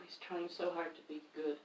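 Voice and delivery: ordinary delivery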